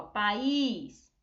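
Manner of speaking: país in two syllables, with a long stressed ÍS; pa-ÍS, leaning on the second syllable; stress on final ÍS. Shown as a demonstration. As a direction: draw out the í in país. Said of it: "muda quando a gente fala país".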